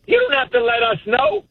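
high-pitched retold brush-off; dismissive mother. Mother telling son the call was needless, mock dismissive. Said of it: "basically dismissive".

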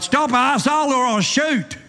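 hammed-up cop's cry; cried out. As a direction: bark the order with a grin in it. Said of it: "and cried".

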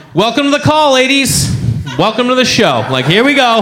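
high-pitched, breezy emcee welcome; mock show host. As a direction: play the host opening a show. Fake host greeting on conference call.

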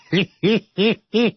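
short laughing burst; burst of laughter. Real, not acted. Laughs at the name.

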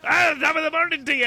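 high-pitched, badly faked Irish greeting; bad Irish accent. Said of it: "I don't know how to do an Irish accent".

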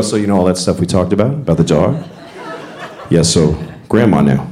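a hint of a gentle dad, low and casual; lightly voiced parent. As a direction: casually break the news to the kids. Parent telling kids grandma died.

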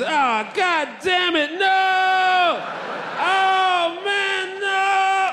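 hammed-up outplayed groan; comic yell of defeat; frustrated guard. Guard outplayed in chess fantasy.

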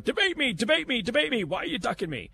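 high, whiny taunt of a flailing candidate; mock a desperate politician begging for a debate. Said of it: "flailing your arms around and going".

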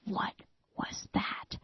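hushed, frightened whisper. Retold or imitated. Husband hearing a noise outside, whispering in fear. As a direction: breathe it out afraid. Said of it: "And Todd says".

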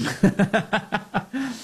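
a burst of high laughter. Real, not acted. Laughing at own joke.

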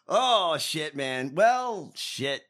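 usual speaking voice

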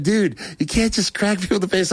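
high, disbelieving friend's protest; friend scolding. Retold or imitated. Friend protests after he punches a guy, high disbelief. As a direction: play the shocked buddy, voice jumping up.

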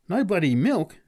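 high, loud, incredulous outburst; exclaiming. A narrator's reading. Man learns Pat brought no milk, exclaiming. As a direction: exclaim in disbelief. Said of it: "exclaimed the man".

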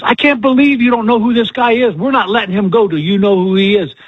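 hammed-up gushing fan who won't let go; insistent fan. Counter lady pressing the young girl, mock insistent. Woman refusing to let him go.

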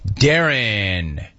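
usual speaking voice

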